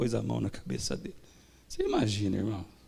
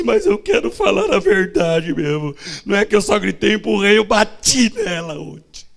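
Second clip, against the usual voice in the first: much higher and louder climax; fervent preaching; 7 semitones higher, 9 dB louder than usual. Acted. Preacher recounting the deacon's tears, loud and high. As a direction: drive the confession home with force.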